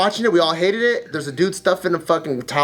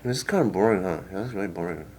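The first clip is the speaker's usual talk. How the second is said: flat bored mumble; 5 semitones lower, 8 dB quieter, faster than usual. Retold or imitated. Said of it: "it's like".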